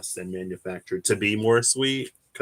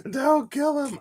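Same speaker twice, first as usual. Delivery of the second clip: high, giggly plea; mock pleading. Teacher begging the class to spare the bee, mock plea. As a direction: squeak it through laughter.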